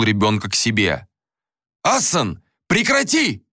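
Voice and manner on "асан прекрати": acted panicked yell at restrained volume; frantic scream. A narrator's reading. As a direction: cry out frantically, voice leaping high. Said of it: "закричал Анатан истошным голосом".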